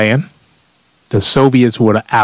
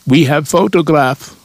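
higher, hammed-up fake Russian accent; Soviet accent impersonation; 7 semitones higher than usual. Soviets blackmailing America over photographs, mock Russian accent. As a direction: do a cartoon Russian, pitched up. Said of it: "That's my Soviet accent impersonation".

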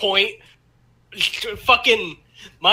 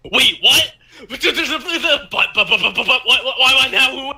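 high, stammering mock panic; hammed-up baffled stutter with laughter in it; flustered disbelief; 6 semitones higher, 3 dB louder than usual. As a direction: sputter in bewilderment, voice jumping up. Acts out flustered texted reaction.

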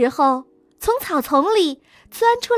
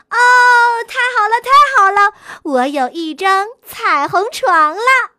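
louder, higher joyful cry; excited shout; 4 semitones higher, 5 dB louder than usual. Acted. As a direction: cheer it out in delight. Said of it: "小狐狸叫着".

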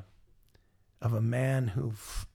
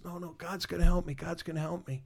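higher-pitched protest, acted as if sinking; gurgling drowning man; 6 semitones higher than usual. Drowning man insists God will save him, mock gurgling. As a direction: imitate a drowning man still refusing help.

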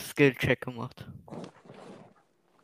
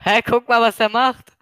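excited; 9 semitones higher, 4 dB louder than usual. Real, not acted. Excited about killer ignoring teammate.

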